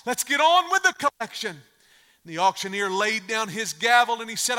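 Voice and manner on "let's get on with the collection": high, loud call from the audience; shouted demand. Acted. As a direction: shout out impatiently to move things along. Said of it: "A man sitting on the second row shouted out".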